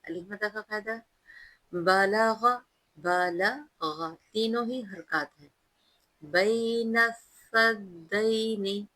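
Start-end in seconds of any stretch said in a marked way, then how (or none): 1.7-4.2 very slow chanted word; tajweed recitation. Shown as a demonstration. Teacher slowly chanting a word for students. 6.2-8.9 slow chanted verse with long vowels; tajweed recitation. Shown as a demonstration. Teacher reciting the verse for students, slow melodic chant. Demonstrates the phrase.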